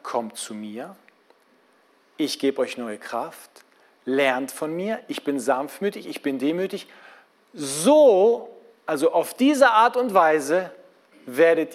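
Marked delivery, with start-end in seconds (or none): 0.0-6.9 tender softer Jesus words. Acted. Jesus quote said softly.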